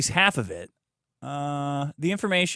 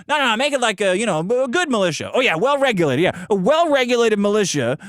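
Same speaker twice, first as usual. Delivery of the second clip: mock lawmakers drafting; 6 semitones higher, 4 dB louder than usual. Acts out drafters arguing over wording.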